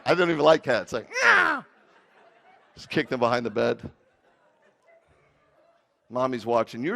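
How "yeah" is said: high, loud cat cry; imitated cat yowl. Imitating the hidden cat for the crowd, yowling. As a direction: let out a sudden cat yowl. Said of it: "You hear the cat".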